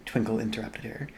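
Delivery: usual speaking voice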